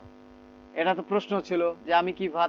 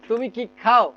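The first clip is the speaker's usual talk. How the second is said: higher-pitched question, weight on খাও; modelled question with খাও punched; stress on খাও; 4 semitones higher, slower than usual. Shown as a demonstration. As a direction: hit খাও at the end. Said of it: "এটা জোর দিয়ে বলেন".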